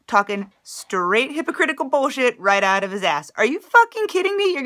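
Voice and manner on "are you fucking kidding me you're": real outrage, pitch jumping up; outraged disbelief. Real, not acted. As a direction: blurt it out in furious disbelief. Outraged at the senator's speech.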